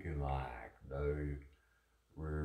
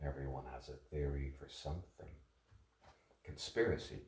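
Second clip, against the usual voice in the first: normal talking voice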